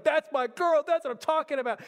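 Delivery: hyped-up, celebratory outburst, pitched high; pumped-up dad. Retold or imitated. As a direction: imitate a dad bursting with pride. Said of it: "He starts getting so pumped up".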